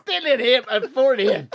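high Scottish caricature, laughing; thick Scottish accent. Retold or imitated. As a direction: order in slurred-together Scots on the edge of laughter. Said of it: "had the same accent as the fella".

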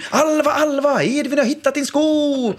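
high, theatrical game-master voice; playful game voice. Retold or imitated. Dad calling his son into a pretend mission, high theatrical voice. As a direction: sell it like a grand adventure to the kids.

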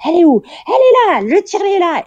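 high, loud alarmed cry; staff shouting. Retold or imitated. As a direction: shout out where the pump is. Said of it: "j'entends genre".

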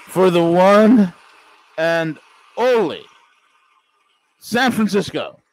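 loud, slow hammed-up award announcement; sarcastic booming host; mock emcee. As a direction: present the winner like a ringmaster. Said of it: "calls for applause like emcee".

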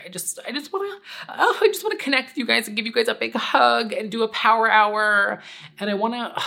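high, squealy longing to hug them all; gushing high. Real, not acted. To her viewers, wishing she could hug them, gushing high. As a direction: voice jumps way up with longing.

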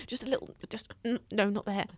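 high, hushed and hesitant hinting; British politeness. Acted. British person hinting at a problem, delicately hushed. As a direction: point it out gingerly, in little fragments. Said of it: "As a British person".

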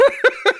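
sudden loud laugh; burst of laughter. Real, not acted. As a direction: laugh out before you can stop it. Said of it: "ich darf nicht lachen". (lautes Loslachen)

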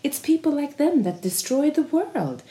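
high, sweet, sing-song voice cooing to dogs; a beach man sweetly telling his dogs the others are crazy; kind friendly tone. Retold or imitated. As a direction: mockingly coo like a man soothing his pets. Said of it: "pratar han så här i snäll och vän ton till de här hundarna".

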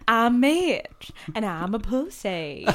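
slow comic Southern drawl; fake Southern accent. Podcaster confessing as Stanley's friend, slow Southern drawl. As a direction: draw it out in mock Southern. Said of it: "so more southern".

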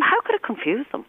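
high, disbelieving question; baffled, the pitch leaps up; retold mock disbelief; incredulous. Retold reply to a parent's remark.

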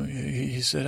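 ordinary talk

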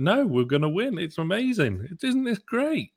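high-pitched, giddy mock excitement; elated fans. Fan base realising we're gonna win, elated. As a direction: soar upward like an overjoyed supporter.

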